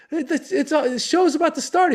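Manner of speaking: very high, stammering urgency; mock panic. Comic warning late host of showtime, high flustered panic. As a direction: splutter it as time runs out.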